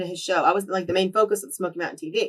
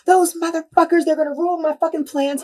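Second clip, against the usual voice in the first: raised, louder furious rant; retold angry outburst; 9 semitones higher, 5 dB louder than usual. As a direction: blow up like the angry boss. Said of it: "He was pissed".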